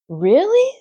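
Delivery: high, amazed little pig; surprised. Acted. Wilbur, astonished, answers Charlotte. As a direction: gasp of disbelief.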